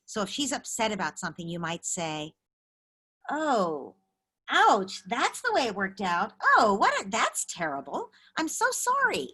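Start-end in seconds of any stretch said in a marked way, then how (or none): 3.3-9.3 higher sympathetic "I'm so sorry" said like a peer; steady, factual empathy with a lift; matter-of-fact sympathy. Shown as a demonstration. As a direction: acknowledge the hurt plainly, friend to friend. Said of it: "a much more matter-of-fact way".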